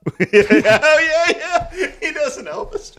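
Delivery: high squeaky laughing voice. Real, not acted. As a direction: talk through giggles.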